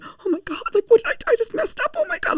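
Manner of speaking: very high-pitched panicked self-talk, acted out; freaking out. Actress alone after meeting the star, imitating her freak-out. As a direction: replay your own squeaky inner panic. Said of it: "I was freaking out".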